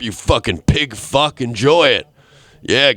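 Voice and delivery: normal talking voice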